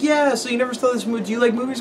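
put-on high curious little boy; kid voice. Kid asks about the drawing, in a high voice. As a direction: ask it like a small kid.

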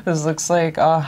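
usual speaking voice

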